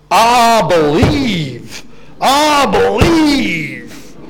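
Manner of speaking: drawn-out, tub-thumping American preacher; hammed-up American televangelist, all-confident and very slow. Preacher parodying his own misreading of Paul, in a tub-thumping American televangelist accent. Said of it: "heard Paul as some kind of tele-evangelist, that kind of all-confident, tub-thumping".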